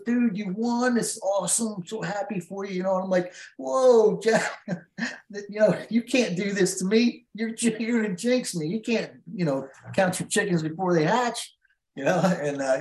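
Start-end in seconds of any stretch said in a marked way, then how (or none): 0.0-2.7 high-pitched retold gushing; excited congratulations. Retold friend congratulating him with a hug. 3.6-11.5 retold high-pitched pleading objection; half-laughing "whoa, don't" plea, pitched up; alarmed protest. Retold protest against premature congratulations.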